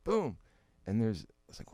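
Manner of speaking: ordinary talk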